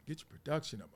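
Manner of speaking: quiet scolding aside. Retold or imitated. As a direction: mutter a note to the producer. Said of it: "Stop, Marcellus".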